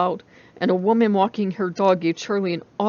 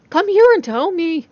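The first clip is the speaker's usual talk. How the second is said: eager friend; 9 semitones higher, 8 dB louder than usual. A narrator's reading.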